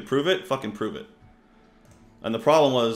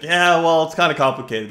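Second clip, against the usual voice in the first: louder, higher mock-defensive reply; hammed-up awkward excuse to the guards; sheepish retold excuse; 7 semitones higher, 12 dB louder than usual. Retold border guard argument.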